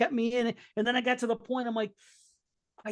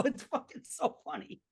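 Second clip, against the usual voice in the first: usual speaking voice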